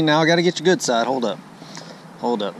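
usual speaking voice

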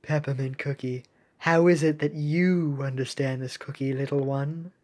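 low, soft, measured question; gentle navy captain. Acted. Captain asking Peppermint Cookie, soft low voice. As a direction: speak softly down to a little one.

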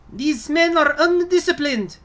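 very high, strained officer voice; high squeaky commander. Acted. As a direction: scold the troops in a squeaky pitch. Commander scolding troops.

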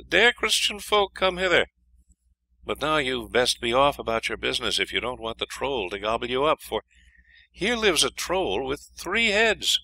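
high, earnest princess voice. A narrator's reading. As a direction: warn him off in a high voice. Princess warns the hero.

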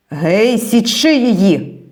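soldiers' shout. A narrator's reading. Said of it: "крикнули ті".

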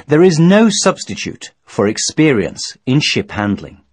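normal talking voice

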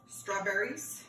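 normal talking voice

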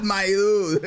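laughing. Real, not acted. Laughing at the bad take.

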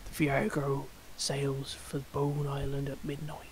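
slow, soft, gruff whisper; gruff harsh whisper. Acted. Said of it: "in a harsh whisper".

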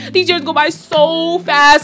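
slow, high, fretful New York whine about time; panicked whine. Retold or imitated. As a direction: whine it like time is slipping away. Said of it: "instead of looking at it as like".

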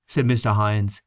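ordinary delivery, nothing marked

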